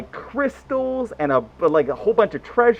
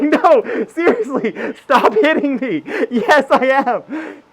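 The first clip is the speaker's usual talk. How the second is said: high-pitched insisting through laughter; laughing mock protest; 6 semitones higher, 3 dB louder, slower than usual. Boyfriend insisting while being slapped, mock protest. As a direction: protest helplessly, half laughing.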